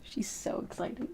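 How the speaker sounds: soft whisper to the chat; whispered aside. Real, not acted. Telling viewers about the excited cat, soft whisper. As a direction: hush it so the cat stays calm.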